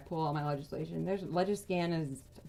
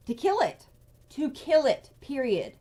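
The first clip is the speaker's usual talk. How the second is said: higher and louder, hard and final; angry accusation; 6 semitones higher, 6 dB louder than usual. Real, not acted. Telling viewers the committee will kill the bill, angry and loud. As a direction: slam the point home. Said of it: "That just lit my fire".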